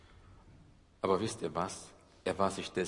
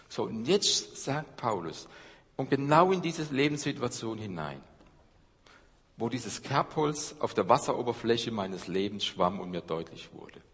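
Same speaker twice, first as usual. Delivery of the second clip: ordinary talk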